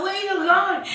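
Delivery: screaming at him. Retold or imitated. Woman grabbing his shirt as he leaves, mock screaming. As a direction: call after him, pitched high. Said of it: "I'm still screaming at him".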